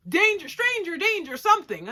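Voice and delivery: loud, high-pitched mock alarm; mock warning. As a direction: yell it like a half-remembered warning.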